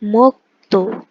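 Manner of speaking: "mokto" in two separate syllables, mok-to; pronunciation demo. Shown as a demonstration. Teacher showing learners how the example word is pronounced, "mokto" sounded out as mok-to. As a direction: sound out "mokto", closing the k before "to". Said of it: "its pronunciation would become".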